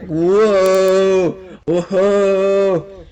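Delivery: long, drawn-out loud whoa; hammed-up stunned wonder; dazed amazement. Tripping student gaping at his teacher, drawn-out amazement.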